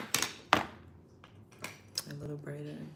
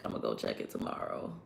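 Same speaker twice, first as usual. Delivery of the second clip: whispered, much softer and lower, muttered aside; whispered to self; 11 dB quieter than usual. Real, not acted. Streamer reminding herself about the renewal, whispered. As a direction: say it under your breath as a reminder to yourself.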